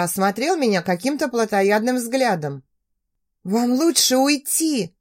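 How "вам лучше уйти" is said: slow, shaky brave front; forced bravery. Acted. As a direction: sound braver than you feel. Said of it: "пыталась храбриться".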